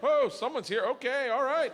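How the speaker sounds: high, loud startled crowd reaction. Acted. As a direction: react out loud to someone walking in. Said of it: "everyone looks like, "Oh, shit".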